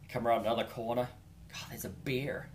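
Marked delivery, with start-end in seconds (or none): 1.5-2.5 whispered in alarm; hushed whisper. Real, not acted. Hiker spotting his first bear, whispered alarm. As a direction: breathe it out, voiceless, startled.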